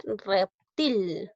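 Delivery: "reptil" slowed into rep-til; teacher syllabifying "reptil"; syllable by syllable. Shown as a demonstration. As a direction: draw out both syllables of "reptil". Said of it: "De esta manera es decir".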